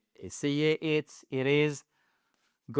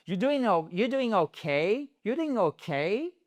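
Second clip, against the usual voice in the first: 'okay' climbing high on 'kay'; rising question tune; 5 semitones higher than usual. Shown as a demonstration. Teacher modelling a yes-no question, rising 'kay'. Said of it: "donc vous remontez".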